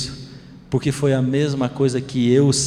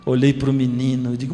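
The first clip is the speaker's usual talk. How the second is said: normal talking voice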